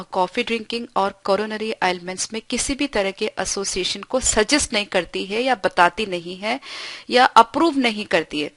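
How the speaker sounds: ordinary talk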